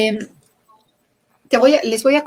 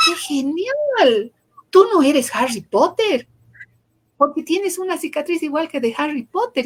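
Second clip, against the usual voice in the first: excited; 6 semitones higher than usual. Retold or imitated. Doctor cheering up boy.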